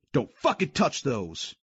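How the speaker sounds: slow angry roar. Acted. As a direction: roar a warning. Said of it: "I roared".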